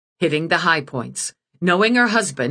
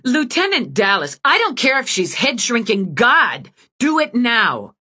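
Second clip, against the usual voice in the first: louder and higher, hammering each word; angry demand; 7 semitones higher, 4 dB louder than usual. Acted. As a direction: lean on her till she gives in. Eve threatens stubborn admin.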